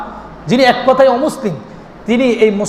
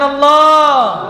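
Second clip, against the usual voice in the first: higher, long-held "Allah"; loving call; 4 semitones higher, much slower than usual. Shown as a demonstration. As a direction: call God's name with love, stretched and ringing. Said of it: "আর একটু মহব্বতে ডাকেন".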